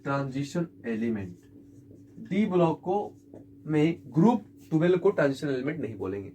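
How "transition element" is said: slow, soft dictation; teacher dictating the answer to the class. Shown as a demonstration. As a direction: let the answer fall quietly and slowly. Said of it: "तो इसको हम लोग क्या बोलेंगे?".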